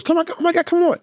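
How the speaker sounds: very high mock-urgent woman's voice; imitated high-pitched urgency; urgent lady. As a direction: squeal it like a flustered woman hurrying someone. Urgent lady as birth starts.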